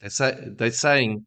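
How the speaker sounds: ordinary talk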